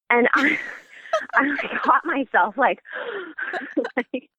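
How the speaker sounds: laughing high in surprise; real laughter. Real, not acted. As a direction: burst out laughing, caught off guard. Caught off guard by child.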